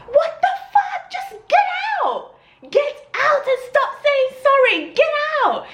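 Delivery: high-pitched, shrieked retort; high, indignant squeal; outraged yell. Retold or imitated. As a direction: re-enact screaming at an intruder to leave. Acting her yell at intruder.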